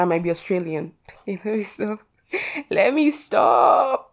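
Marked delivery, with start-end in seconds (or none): 1.1-2.0 soft, squeezed through a laugh. Real, not acted. Host laughing at her own Australian joke, giggly. 2.7-4.1 very high, drawn-out singsong; playful self-scolding. Real, not acted. Host reining in her own joke, playful high drawl.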